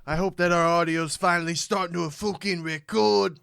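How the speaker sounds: loud Scottish brogue; booming Scottish voice; Scottish accent. Pretend. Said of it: "What was that?".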